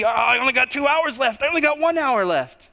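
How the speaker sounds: high-pitched, hammed-up clock-watching panic; mock panic. Cinderella checking the clock at the dance, mock panic.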